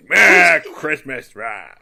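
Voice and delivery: loud, drawn-out grumbling burst, dropping away; Ben's humbug. Acted. Mimicking Ben as a Scrooge, loud humbug growl. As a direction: blurt it slowly like a humbugging grouch. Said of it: "stops just short of saying the words".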